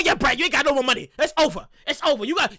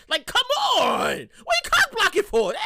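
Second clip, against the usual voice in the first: mock frustrated yell; 9 semitones higher than usual. Frustrated at friend.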